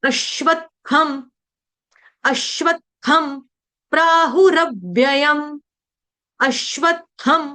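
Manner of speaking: slow model of अश्वत्थम with an aspirated थ; careful Sanskrit pronunciation. Shown as a demonstration. Teacher demonstrating the word to students, slow careful pronunciation. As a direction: draw out प्राहुरव्ययम syllable by syllable. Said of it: "आपको इसका उच्चारण करना है".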